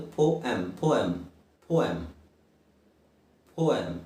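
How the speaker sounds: ಪೋಯಮ್ said low and falling, repeated; correct demo. Shown as a demonstration. Teacher repeating the right form for learners, careful pronunciation. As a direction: model ಪೋಯಮ್ as poem, no final em. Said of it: "ಎರಡು ರೀತಿ ಹೇಳ್ತಾರೆ".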